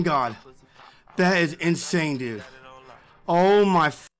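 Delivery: ordinary delivery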